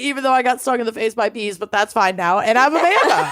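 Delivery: ordinary talk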